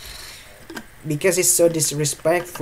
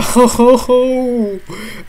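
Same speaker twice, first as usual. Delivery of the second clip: high loud nervous laugh; a shrieking scared laugh; panicked laugh; 8 semitones higher, 7 dB louder than usual. Real, not acted. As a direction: laugh out the fear. Nervous laugh while afraid.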